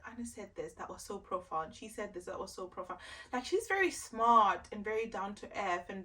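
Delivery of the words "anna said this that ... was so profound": inner thought. Retold or imitated. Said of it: "I'm like".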